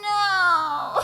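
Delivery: a sobbing no; crying no. Acted. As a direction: push the comfort away through tears. Irma crying, refusing comfort.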